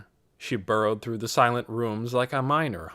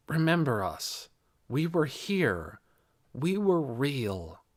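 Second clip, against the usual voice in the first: slow, drawn-out and solemn; slow plea; 3 semitones higher, slower than usual. A narrator's reading. Voices from old letters asking to be remembered, slow plea. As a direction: stretch each short phrase, understated. Old writings speak to the girl.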